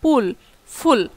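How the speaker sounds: পুল plain, then ফুল with a strong puff of air, slow; slow pair, extra air on ফুল; aspiration contrast. Shown as a demonstration. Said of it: "দ্বিতীয় শব্দে কিন্তু বাতাসটা বেশি".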